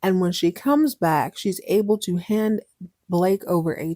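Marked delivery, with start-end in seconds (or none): none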